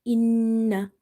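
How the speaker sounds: "Inna" with a long nasal n, then dropped; held nun. Shown as a demonstration. Teacher showing the gunna, held nasal n. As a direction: hum on the n of "Inna" before releasing it. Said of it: "Hold the nun sound and then drop it".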